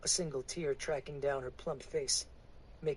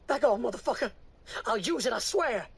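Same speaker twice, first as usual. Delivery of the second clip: high, loud threatening woman's voice; cornered woman; 9 semitones higher, 5 dB louder than usual. Acted. Woman threatening the preacher's men, high panicked shout. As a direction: shout it with the gun shaking in your hand.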